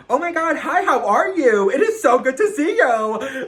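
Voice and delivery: very high, loud gushing greeting; overly excited act. Retold or imitated. Putting on a crazy act greeting an ex, overly excited. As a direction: squeal a hammed-up, over-the-top hello. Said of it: "So I put on this crazy act".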